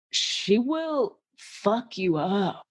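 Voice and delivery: half-speed, word by word; drawn-out playful threat; slow deliberate warning. Acted. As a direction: space it out for menace before laughing. Mock warning about the lioness.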